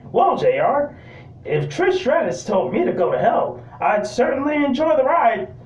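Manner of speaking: high-pitched lecherous commentator impression; Jerry Lawler. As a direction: pitch it up, playing the naughty ringside announcer. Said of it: "Jerry Lawler's response was".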